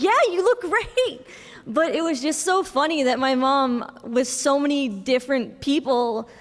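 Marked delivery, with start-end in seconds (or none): 0.0-1.3 high, slowed, delighted compliment; amused nurse. Retold or imitated. Nurse compliments the dressed-up mom, high and delighted. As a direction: imitate him gushing, pitched well up. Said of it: "And he's like".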